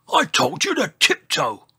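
high, loud, angry scolding; roaring. A narrator's reading. Old Triceratops scolds the giant, roaring. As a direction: roar the telling-off. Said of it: "he roared".

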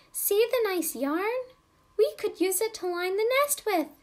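high, eager little mother bird voice; Mamma Robin. Acted. Mamma Robin telling Daddy Robin about the yarn, high and eager. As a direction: chirp it up high like a small bird showing off a find. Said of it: "she said".

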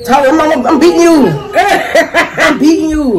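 laughing, bragging she's winning; gloating laugh. Real, not acted.

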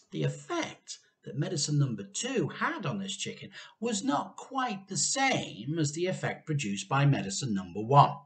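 usual speaking voice

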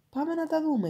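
eager to go and look; cheerful. Acted. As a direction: princess urging her father, cheerfully. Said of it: "είπε χαρούμενη".

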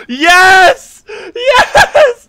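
high, loud laughing yes; cheering. Real, not acted. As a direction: shout in triumph. Cheering a win.

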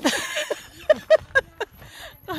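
high bursting giggle. Real, not acted. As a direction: laugh out high and loud. Laughs at own wish.

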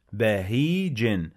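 بهيج slow, the ي held long; teacher models the long vowel; long vowel demo. Shown as a demonstration. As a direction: stretch the ي in بهيج. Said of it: "مد بالياء".

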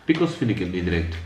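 ordinary delivery

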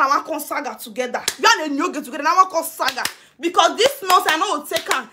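normal talking voice

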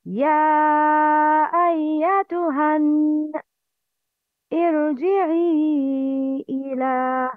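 slow melodic tajweed chant; Quran recitation. Shown as a demonstration. Teacher recites the verse for students to repeat. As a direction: recite slowly, stretching ارجعي إلى. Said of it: "Please repeat".

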